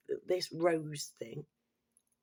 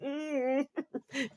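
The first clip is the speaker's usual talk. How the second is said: cracking up at the comeback; big laugh; 8 semitones higher than usual. Real, not acted. Laughing at her own comeback.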